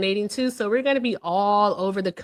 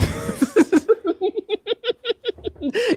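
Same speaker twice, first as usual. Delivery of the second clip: high-pitched burst of laughter; squealing laugh at her friend. Real, not acted.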